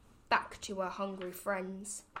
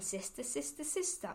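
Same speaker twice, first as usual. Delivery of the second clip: cave echo; 3 semitones higher, 6 dB quieter than usual. A narrator's reading. The cave answering the duck's call, as a soft echo. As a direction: let it come back faint, like an echo. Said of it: "a voice from the cave came back".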